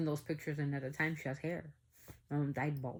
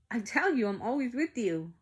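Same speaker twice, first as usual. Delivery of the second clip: higher, louder teasing insistence; imitating mom's mock-exasperated reassurance; 7 semitones higher, 5 dB louder than usual. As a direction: insist it loudly with playful mockery. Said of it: "Kinda, like, in a mocking voice".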